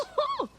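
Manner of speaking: bright burst of high laughter; big laugh. Acted. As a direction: laugh it off heartily. Kensa laughs heartily.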